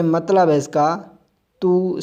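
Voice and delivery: usual speaking voice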